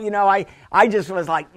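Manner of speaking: normal talking voice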